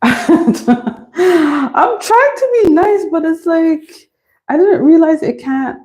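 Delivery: high, drawn-out playful whine; laughing into a high-pitched complaint; mock whine. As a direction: plead in a high, stretched voice. Mock whining while struggling with task.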